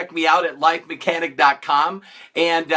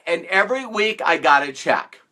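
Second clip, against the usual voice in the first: ordinary talk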